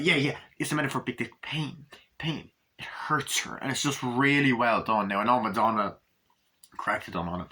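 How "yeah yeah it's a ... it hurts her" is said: mock dramatic whisper. Redoing the mock metaphor line.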